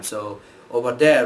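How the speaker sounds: ordinary talk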